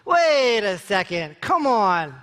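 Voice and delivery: slow, stretched-out objection; drawn-out protest. Acted. Talking yourself out of embarrassment at a party, drawn-out protest. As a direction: drag out each phrase in protest.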